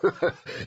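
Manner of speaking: short hearty bark of a laugh. Acted. Lord Aran laughs at the hero's joke, barking. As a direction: bark out one amused laugh. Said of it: "Aran laughed, a deep, rich bark".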